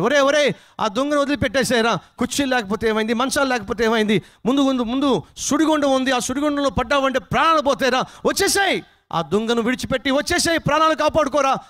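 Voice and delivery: high, urgent warning yells; villagers shouting. Acted. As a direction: yell as a crowd warning a man in danger. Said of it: "అరుస్తున్నారు, కేకలు వేస్తున్నారు". (ఊరివాళ్ళు హెచ్చరిస్తూ అరుపులు)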